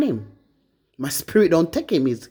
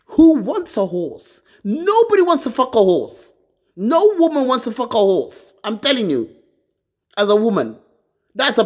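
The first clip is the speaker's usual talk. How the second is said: loud angry rant; 6 semitones higher, 5 dB louder than usual. Real, not acted. Raging to her viewers about her ex, loud and scornful. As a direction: hammer home how disgusting he is.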